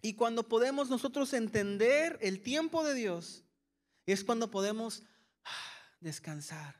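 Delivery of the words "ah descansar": relaxed sigh. Shown as a demonstration. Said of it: "Hágale conmigo así".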